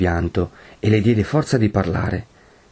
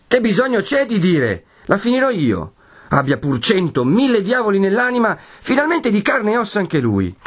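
high-pitched heated rant; furious vow; 12 semitones higher than usual. A narrator's reading. Renzo vows to end it himself, furious and high. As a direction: rage on with defiant resolve.

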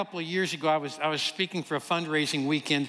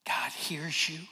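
soft unvoiced whisper; whispered comfort; 3 semitones lower, 5 dB quieter, slower than usual. Wife comforting the lonely waitress, hushed whisper. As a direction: whisper it to soothe her. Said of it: "She said".

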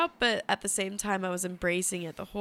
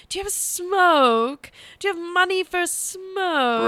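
funny voice; 9 semitones higher, 7 dB louder than usual. Retold or imitated. Woman imitating the man leaning in for a smoke, creepy funny voice. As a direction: imitate a creepy stranger, stretching the last word. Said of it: "he would, like, lean in".

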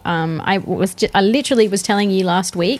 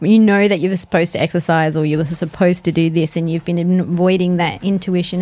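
ordinary delivery

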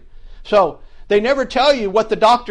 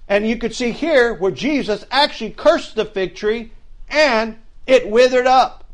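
normal talking voice

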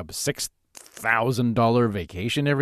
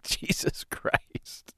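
whisper; 11 dB quieter, much slower than usual. Retold or imitated. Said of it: "she's like".

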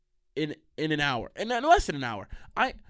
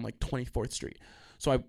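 normal talking voice

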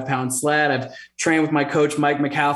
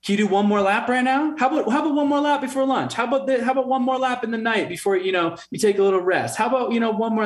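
high, fast, coaxing imitation of a pushy woman; nagging high voice; 8 semitones higher, much faster than usual. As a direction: rattle it off high and quick, wheedling. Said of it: "She's like".

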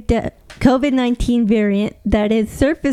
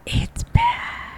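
softer, drawn-out eerie whisper; spooky whisper; 5 dB quieter than usual. Acted. Co-host echoes the news that covid is back, spooky whisper. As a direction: echo it in a spooky hush.

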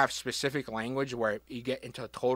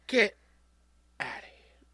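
whispered disbelief; 5 semitones higher, slower than usual. Acted. Mock amazement.

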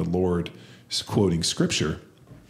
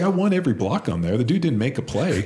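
indignant protest; 6 semitones higher, 3 dB louder than usual. Retold or imitated. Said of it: "I was like".

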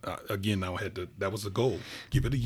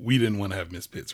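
usual speaking voice

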